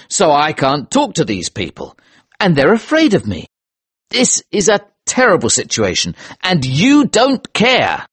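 high, agitated accusation; bitter, emphatic grievance, pitch swooping; angry cry. Acted. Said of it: "I cried angrily".

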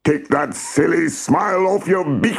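high-pitched bullying cockerel; very rude. A narrator's reading. Cockerel threatens old Aunt Feather, very rudely. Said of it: "he was very rude".

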